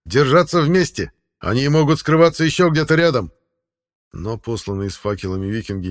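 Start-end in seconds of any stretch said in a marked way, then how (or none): 0.0-3.3 high, loud shouted warning. Acted. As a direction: shout across the camp to warn. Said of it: "крик конунга".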